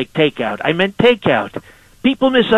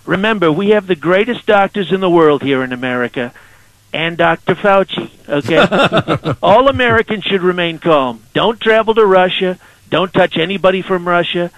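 Donald Trump impression. Trump calling in to radio hosts, swaggering and boastful. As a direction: sell every line like the president on a call-in.

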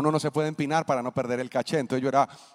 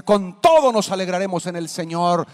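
preaching declaration; 4 semitones higher, 7 dB louder than usual. Acted. Preaching climax.

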